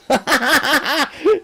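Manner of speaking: high, gleeful run of laughter; real laugh. Real, not acted. Streamer telling the Mars bar tale, laughing high. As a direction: crack up at your own punchline.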